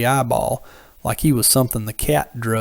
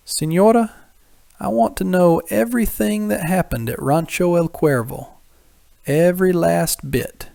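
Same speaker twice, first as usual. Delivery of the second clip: higher, pressing gentleman's voice; earnest Don Esteban; 6 semitones higher than usual. A narrator's reading. Don Esteban pressing the señora for the whole story, higher voice. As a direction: ask firmly for every detail, a lighter higher voice.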